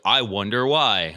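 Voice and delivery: loud sarcasm. Retold or imitated. Owner answering kids already kicked out, slow loud sarcasm. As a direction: drag it out sarcastically.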